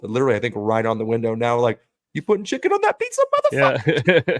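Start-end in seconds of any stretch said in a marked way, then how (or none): 2.1-4.4 very high, squawky chicken yelling. Pretend. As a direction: voice the angry chicken on the window. Voicing the chicken on the window.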